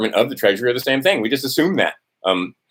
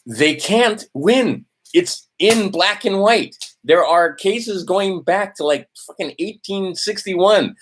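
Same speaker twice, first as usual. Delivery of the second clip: slow emphatic; 7 semitones higher, slower than usual. Real, not acted. Emphatic rallying close.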